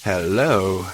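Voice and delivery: usual speaking voice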